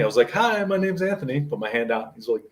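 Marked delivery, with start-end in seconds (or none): 0.3-1.4 put-on chirpy teen greeting. Retelling own teen greeting.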